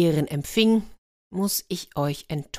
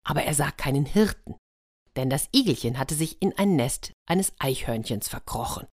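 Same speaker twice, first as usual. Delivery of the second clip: ordinary delivery, nothing marked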